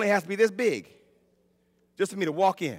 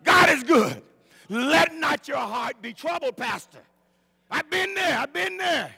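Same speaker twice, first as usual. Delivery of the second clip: raised, drawn-out preacher's cry; fervent preaching; 5 semitones higher, 3 dB louder, slower than usual. Acted. Preacher assuring the pastor he has been there, fervent preaching. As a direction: proclaim it to the church.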